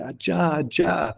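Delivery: retold cry to the wind, high and half-hearted; calling to the wind. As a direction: imitate her crying out to the wind, lightly. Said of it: "she cries out to the wind".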